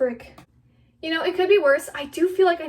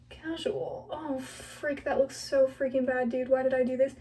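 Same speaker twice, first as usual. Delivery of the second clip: ordinary talk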